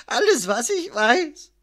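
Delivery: high-pitched, broken sob; sobbing. A narrator's reading. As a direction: sob it out as a beaten man. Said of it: "schluchzte Promero". (schluchzend, mit hoher Stimme)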